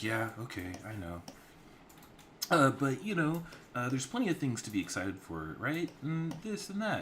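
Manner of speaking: younger sister voice. Acted.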